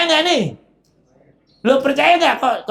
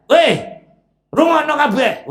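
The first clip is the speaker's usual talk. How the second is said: higher and louder, calling the crowd to listen; angry Iblis; 8 semitones higher, 4 dB louder than usual. Acted. Iblis angrily commanding his followers to listen. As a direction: bark an order to pay attention. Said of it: "ini marah".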